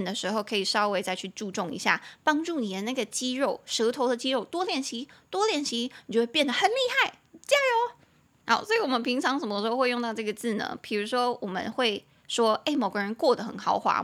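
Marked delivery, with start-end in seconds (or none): 6.1-7.9 quick, much higher encouraging cheer; peppy teacher cheering her class on. Real, not acted. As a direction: pump the learners up. Cheering on learners.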